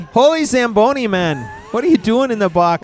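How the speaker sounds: high, loud hockey-fan Canadian; hollering Canadian hockey fan; excited Canadian accent. Pretend. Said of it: "we like to whip out our best Canadian accents".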